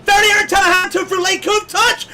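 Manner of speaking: high, loud excited commentary; excited shout. Real, not acted. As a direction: call the crash at full pitch. Crash seen live in commentary.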